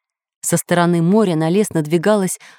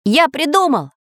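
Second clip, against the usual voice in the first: high excited cartoon voice; eureka shout; 8 semitones higher than usual. The inventor with a sudden idea, high excited shout. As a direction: burst out with the idea. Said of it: "закричал Самоделкин".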